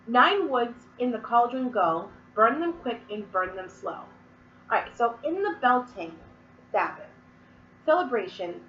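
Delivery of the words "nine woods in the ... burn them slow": slow, louder chant-like reading; incantation recital. Acted. Witch reading the Beltane verse aloud, slow louder chant. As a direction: declaim it like a spell.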